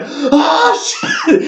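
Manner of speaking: high-pitched mock yelp of fright; retold startled cry. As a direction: yelp as if something jumped out. Retold fright at badger's face.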